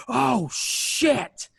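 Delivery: high, loud mock scream; hammed-up screamed exclamation, pitch shooting up; imitated scream. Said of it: "Heyman very audibly screams".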